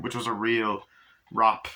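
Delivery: usual speaking voice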